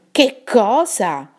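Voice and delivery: Fulmine's stunned protest; rebellious protest. Acted. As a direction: drag it out in indignant disbelief. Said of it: "si ribellò Fulmine".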